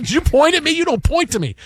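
high indignant quote; quoting her. Retold or imitated. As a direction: mimic her calling him out. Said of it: "She goes".

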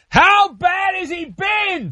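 high, drawn-out crowd-style shout; hammed-up high holler; yelling. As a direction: yell it like an audience feeding a joke. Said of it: "Thanks for yelling".

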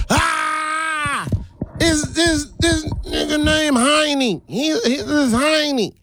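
high, mocking shout of the jeering kids; mocking classmates. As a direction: jeer and crow like a rowdy classroom. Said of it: "class lit up. Everybody laugh".

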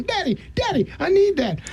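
high pleading little-girl voice, mock begging; imitating his daughter. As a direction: beg like a kid who wants the truck. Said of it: "I got a daughter that's gonna be going".

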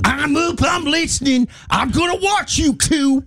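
high sing-song voice, slow on the last line; a teasing singsong impression; mock Jessica voice. Host imitating Jessica listening to the show.

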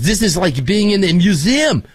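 put-on French accent. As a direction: imitate a tourist with a French accent. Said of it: "I'll do a French accent".

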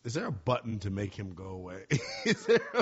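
laughing while asking; giggling through the question. Real, not acted. Host laughing at his cohost's interruption.

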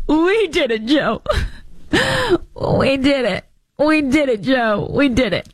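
high, giddy, laughing cheer; imitating Kamala Harris. Host mimicking Kamala's phone call to Joe, high and laughing. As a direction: cackle through it like a giddy fan. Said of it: "And then you have Kamala Harris".

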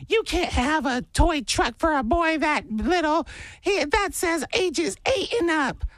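high, slow mock-scolding lady; scolding stranger. Host imitates a lady scolding him, high and slow. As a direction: nag like a fussy stranger in a store. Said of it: "This lady the other day yelled at me and goes".

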